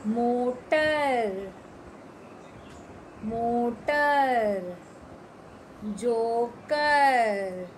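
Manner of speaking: मोटर and जोकर stretched very slowly; slow word reading. Shown as a demonstration. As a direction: sound out each word for kids. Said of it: "तीसरी line पर आइए".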